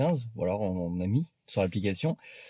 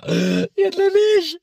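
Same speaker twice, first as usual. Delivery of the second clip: high excited squeal; 8 dB louder than usual. Real, not acted. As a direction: squeal with delight at the snow. Snow found at the parking lot.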